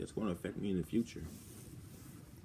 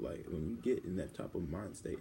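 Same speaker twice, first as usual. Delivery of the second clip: ordinary talk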